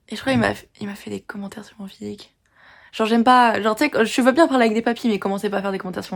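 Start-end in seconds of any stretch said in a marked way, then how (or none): 0.1-2.3 whispering the awkward detail; whispered aside. Real, not acted. Confiding to viewers in a whisper about his comments. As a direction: whisper it like a secret.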